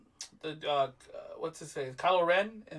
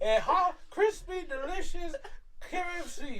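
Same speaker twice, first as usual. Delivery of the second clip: higher Southern ad pitch, word by word; Colonel Sanders; 7 semitones higher than usual. Acted. Friend sells fried chicken as the colonel, hammed-up pitch. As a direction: savour each word, fading at the end. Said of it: "he's basically Colonel Sanders".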